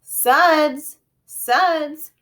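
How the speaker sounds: loud high call from far off; distant calling. A narrator's reading. Mother calling her son from far away, loud call. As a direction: call the name across a distance. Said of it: "far, far away voice".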